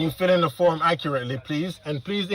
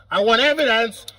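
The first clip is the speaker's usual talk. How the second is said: real raised voice at the police officer; 5 semitones higher, slower than usual. Real, not acted. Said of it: "Stop raising your voice".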